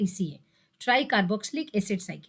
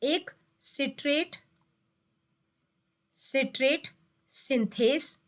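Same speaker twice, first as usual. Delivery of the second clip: slow careful naming; slower than usual. Shown as a demonstration. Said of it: "ये दो important enzymes हैं".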